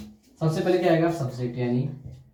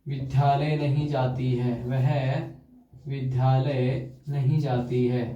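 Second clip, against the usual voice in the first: slow dictation; 3 semitones lower, slower than usual. Shown as a demonstration. Teacher dictating example sentence.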